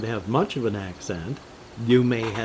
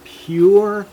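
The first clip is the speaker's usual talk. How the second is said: excited burst; 9 semitones higher, 11 dB louder than usual. Real, not acted. Sudden loud enthusiasm.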